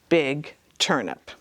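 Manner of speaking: ordinary delivery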